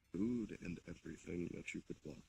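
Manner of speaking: ordinary talk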